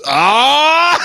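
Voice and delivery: loud high martial-arts yell; hammed-up fighter's cry; kiai shout. Mocking the neighbor's loud high karate yell. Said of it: "and he goes".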